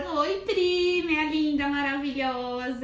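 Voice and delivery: high, loud, doting hello; sweet high greeting. Real, not acted. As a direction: coo over a friend arriving. Greeting friend joining live.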